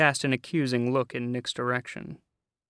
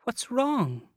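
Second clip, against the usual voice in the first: high, sweet worried voice; cooing concern; 11 semitones higher than usual. A narrator's reading. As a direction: coo with concern. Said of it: "she cooed".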